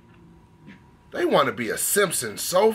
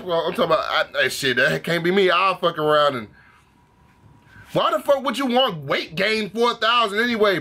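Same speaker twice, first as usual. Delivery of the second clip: ordinary delivery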